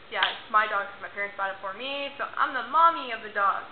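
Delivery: ordinary talk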